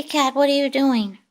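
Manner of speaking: ordinary talk, nothing marked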